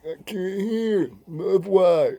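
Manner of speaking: high, slow deaf-speech impression; high, slow deaf voice explaining why; deaf friend voice. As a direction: drag it out high like he can't hear.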